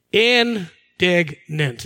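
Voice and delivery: Indignant drawn out very slowly, low; savouring the word Indignant. Shown as a demonstration. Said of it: "what the definition of indignant is".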